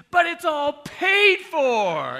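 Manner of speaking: high, very loud, stretched-out cashier call; acted triumphant holler, drawn long; gleeful announcement. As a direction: belt it out like a game-show reveal. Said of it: "the cashier started getting into it".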